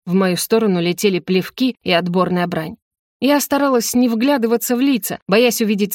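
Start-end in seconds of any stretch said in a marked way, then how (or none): none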